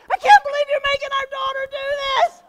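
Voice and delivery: very high, loud, fast mock outrage; wife's outrage. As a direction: shriek it as the scandalized wife. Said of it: "my wife's like".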